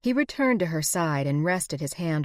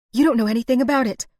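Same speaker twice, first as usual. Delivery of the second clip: spat out; 7 semitones higher, 8 dB louder, much faster than usual. A narrator's reading. Sarah snaps at Garrison, spat out angrily. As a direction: spit it out high, loud and fast. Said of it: "she practically spat".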